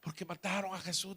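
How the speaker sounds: softly voiced child's lament, lightly acted; a hint of a small child crying; imitating tearful child. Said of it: "el niño llorando".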